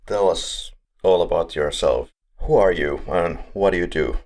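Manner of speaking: normal talking voice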